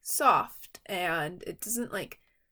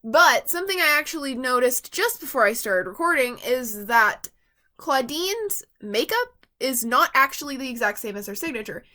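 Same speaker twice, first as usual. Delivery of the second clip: ordinary talk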